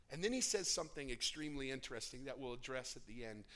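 ordinary delivery

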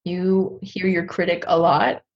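ordinary talk, nothing marked

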